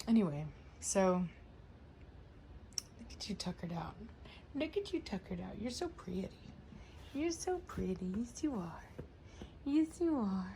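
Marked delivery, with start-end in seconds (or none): 3.0-3.9 soft unvoiced whisper to the dog; whispering sweetly to her sleepy dog. Real, not acted. 4.5-10.5 pet-voice praise for her pretty dog. Real, not acted. Baby talk to the dog.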